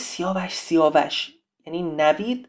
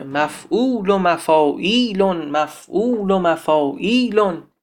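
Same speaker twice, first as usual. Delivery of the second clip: slow "مفعول و مفاعیلون", long vowels stretched in rhythm; scanning the metre; slower than usual. Shown as a demonstration. Teacher names the metre for keen students, "مفعول و مفاعیلون" chanted rhythmically. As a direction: chant "مفعول و مفاعیلون" as evenly measured feet.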